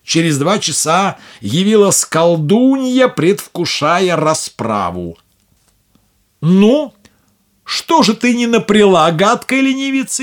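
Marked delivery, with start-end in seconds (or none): 6.4-10.2 high, loud screeching witch. Acted. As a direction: screech at the lazy girl. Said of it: "завопила она".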